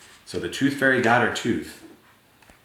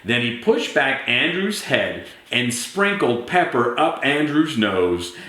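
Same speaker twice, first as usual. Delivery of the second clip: usual speaking voice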